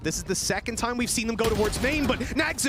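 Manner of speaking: high, lively play-by-play; esports caster. Acted. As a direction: call the fight as it unfolds. Live play-by-play call.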